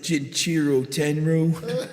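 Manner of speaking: put-on slow, laughing "Jinchiro Tenru" in a New York accent; bad Dickinson impression. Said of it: "I do a really bad Dickinson".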